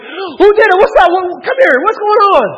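high, loud rush of questions; father shouting high to his crying kid; alarmed dad. Retold or imitated. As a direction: storm in demanding who did it. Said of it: "I'm just like".

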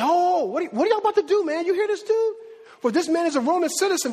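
high, urgent warning; alarmed. Acted. The centurion warns the tribune, high and urgent. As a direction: sound the alarm to your boss.

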